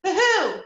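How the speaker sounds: high hoo-hoo said the wrong way; a silly special-owl hoot; the hoot not to copy. Shown as a demonstration. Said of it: "make sure it doesn't sound like".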